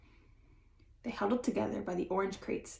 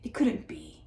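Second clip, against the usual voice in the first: ordinary delivery, nothing marked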